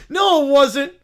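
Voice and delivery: put-on loud, high childish protest; indignant denial. Child told it was funny, loud indignation. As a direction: contradict them crossly.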